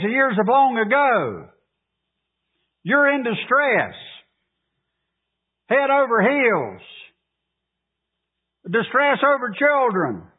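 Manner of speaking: high, driving sermon voice; lifted, urgent pulpit delivery; fervent preaching. Acted. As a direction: preach it with rising fervor. Preaching at full force.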